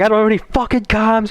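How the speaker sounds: high, exasperated complaint; re-enacted anger. Player retelling his own dead-comms meltdown, mock anger. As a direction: pitch it up into a mock gamer tantrum. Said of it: "I was so mad".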